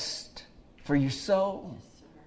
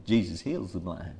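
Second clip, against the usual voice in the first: hushed; 5 semitones lower, 6 dB quieter than usual. Real, not acted. Preacher confiding to the congregation, hushed and low. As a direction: lower and softer, drawn in close. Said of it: "Let me tell you something".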